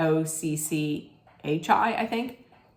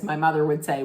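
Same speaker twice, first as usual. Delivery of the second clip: ordinary talk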